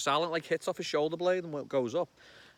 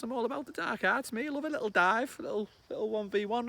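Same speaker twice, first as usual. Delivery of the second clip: ordinary talk, nothing marked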